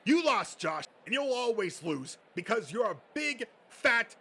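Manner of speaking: loud, high screaming rage; high, loud tirade building to a scream; furious yelling. Acted. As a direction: unload years of spite on him. Bill finally explodes at Josh.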